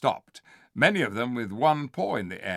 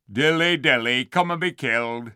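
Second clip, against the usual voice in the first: sneering; 2 semitones higher, 6 dB louder than usual. Acted. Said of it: "sneered Griffle".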